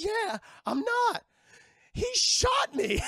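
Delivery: very high, loud, slow squeal; hammed-up surprise. Baldy telling the crew he was shot, a high slow squeal. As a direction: squeal in comic disbelief at being alive. Shot pirate realizes he's undead.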